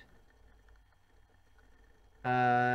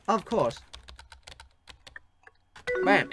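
normal talking voice

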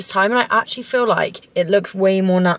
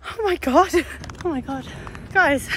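high, panting gasps after a sprint; out of breath; 9 semitones higher than usual. Real, not acted. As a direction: gasp it out, winded and high. Just finished a hard interval.

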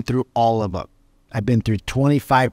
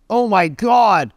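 mock outburst; 7 semitones higher, 4 dB louder, slower than usual. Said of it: "start screaming and yelling at me".

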